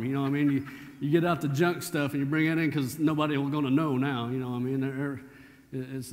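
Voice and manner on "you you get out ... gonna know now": ordinary talk, nothing marked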